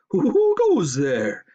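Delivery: high, loud, slow alarmed call; startled owl call; alarmed hoot. Acted. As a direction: hoot the challenge into the dark. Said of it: "hooted Liam in alarm".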